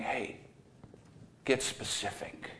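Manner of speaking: a quiet, whispered urging; soft whisper. Acted. Jesus gently urges the blind man, whispered. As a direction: lean in and urge gently.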